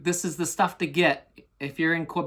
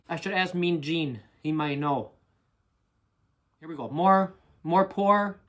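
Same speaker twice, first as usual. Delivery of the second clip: put-on Minnesota-Canadian hoser accent. Hoser greets internet viewers about Canadian beer, mock Canadian accent. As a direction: talk like a folksy Canadian hoser, eh.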